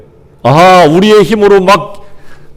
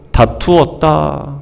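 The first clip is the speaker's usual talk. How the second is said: slow weighty reading; 5 dB quieter, much slower than usual. Shown as a demonstration. Preacher reads the verse slowly.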